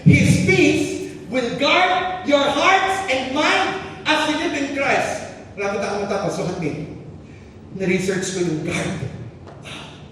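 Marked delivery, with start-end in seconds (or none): 0.0-5.3 high, loud, very slow recitation; solemn verse reading. Real, not acted. Pastor quoting the Bible on peace, solemn and slow. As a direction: savour the verse word by word.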